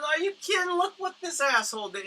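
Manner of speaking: can't believe what he sees; outraged disbelief. Retold or imitated. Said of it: "He goes".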